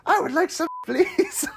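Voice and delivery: high-pitched creepy plea; Frank. Retold or imitated. Frank acting out his request, high and creepy. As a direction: squeal it creepily, half laughing. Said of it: "he's, like, acting it out".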